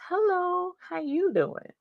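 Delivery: high lilting hello to a viewer; sing-song greeting. Real, not acted. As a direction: toss it up high and bright.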